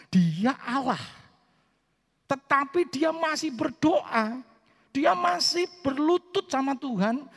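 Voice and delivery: high, loud, slow proclamation; full-force preaching. Real, not acted. Preacher proclaiming that even God still prayed, full-force preaching. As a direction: drive each phrase home to the congregation, high and loud.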